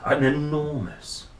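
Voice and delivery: ordinary delivery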